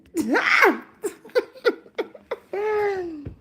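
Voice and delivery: a sudden high, loud burst of laughter. Real, not acted. Laughing at her own fake-out, loud laugh.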